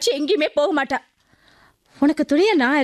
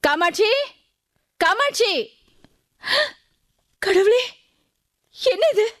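higher, anxious calls of the name; alarmed calling; 6 semitones higher than usual. Acted. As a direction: call out in rising alarm. Neighbour calls, finds girl collapsed.

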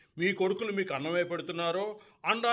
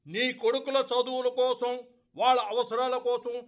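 raised, high-pitched voice meant to carry; deliberately loud; 5 semitones higher, 4 dB louder than usual. Acted. Friend loudly listing the father's debts so the sons hear, raised voice. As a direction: speak up loudly so the whole house hears. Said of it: "అంత గట్టిగా మాట్లాడకండి".

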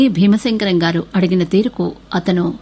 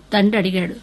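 ordinary delivery, nothing marked

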